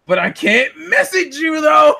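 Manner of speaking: usual speaking voice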